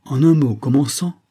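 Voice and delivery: pompous father making an announcement; solemn air. A narrator's reading. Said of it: "prenant un air solennel".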